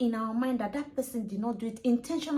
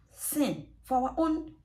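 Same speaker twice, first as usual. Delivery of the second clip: normal talking voice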